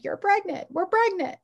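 light up with the news; joyful realization. Retold or imitated.

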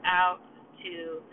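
guided breathing count, paced with long pauses; slow breath count. Shown as a demonstration. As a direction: count out each breath slowly. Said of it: "So do it nice and slow".

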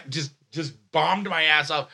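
ordinary talk, nothing marked

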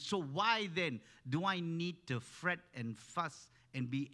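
loud, high, impassioned sermon delivery; fervent preaching. Acted. Preacher urging the congregation to trust Jesus, loud and impassioned. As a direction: preach it with rising fire. Preacher builds to full force.

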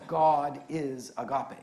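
God is agape, slow and weighty; preacher pressing the scripture home; stress on God. Acted.

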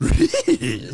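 high wheezing dog laugh; Scooby laugh imitation. Trying the Scooby laugh for his friend. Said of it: "how's he laugh".

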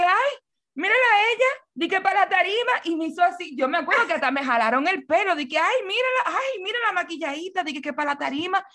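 mock her like a jeering woman. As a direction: crowd woman taunting the made-up girl. Mocking woman in crowd.

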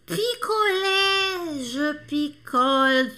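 picolé stretched very slowly; drawn out. Shown as a demonstration. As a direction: draw out each syllable of picolé. Stretched teaching demo of picolé. (picolé bem devagar e alongado)

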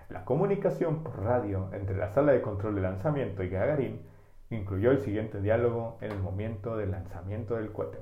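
ordinary delivery

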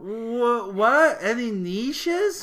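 high-pitched, baffled disbelief; drawn-out, scornful questioning; incredulous. Real, not acted. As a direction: stretch the odd word out slowly in disbelief. Incredulous at his odd wording.

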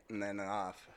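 ordinary talk

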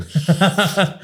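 a burst of real laughter. Real, not acted. Laughing hard at his own story with his friend.

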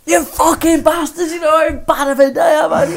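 hammed-up outrage; 1 mock furious shout. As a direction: rant at the pranksters. Retold furious reaction.

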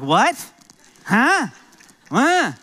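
loud, high, rude what-huh; blunt what. Retold or imitated. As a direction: bark it like an impatient dad. Mimicking his own blunt replies.

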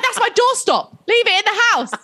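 high, indignant mock plea; mock protest. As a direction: protest to the friends, pitched right up. Retold protest to friends.

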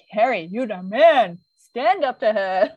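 slow, emphatic rallying call; egging him on like a coach; slow hammed-up encouragement; mock pep talk. Urging Terry in retelling.

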